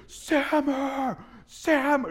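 a roaring voice calling the name out loud and high; booming call. Retold or imitated. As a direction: call the name like a voice thundering from within.

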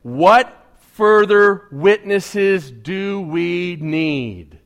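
outraged high priest. Acted. Pastor reads the outraged high priest to his congregation, loud and slow. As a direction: rend your robes and demand it, slowly. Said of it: "the high priest tore his garments and said".

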